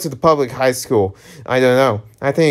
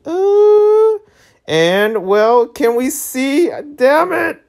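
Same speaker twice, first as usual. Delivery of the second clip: shocked high voice; 13 semitones higher, 4 dB louder, much slower than usual. Acted. Shocked at the spell.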